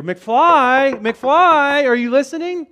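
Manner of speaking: loud, high, taunting call; Back to the Future impression. Likening Jesus to the movie scene, loud taunting call. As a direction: holler it mockingly to someone not paying attention. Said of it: "Back to the Future".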